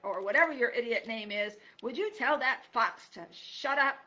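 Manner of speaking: put-on old porcupine losing patience; cranky loud demand. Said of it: "Aerith cried".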